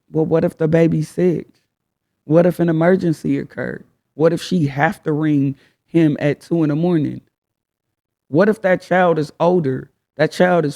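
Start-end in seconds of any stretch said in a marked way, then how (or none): none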